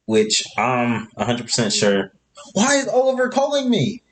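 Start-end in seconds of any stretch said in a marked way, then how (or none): 2.5-4.0 surprised. Real, not acted. Phone suddenly rings mid-recording.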